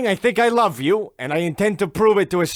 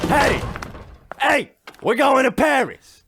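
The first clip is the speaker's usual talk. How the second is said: hailing his wife from afar with big news; husband shouting the Paris news to his wife; excited calling out; 6 semitones higher than usual. Acted.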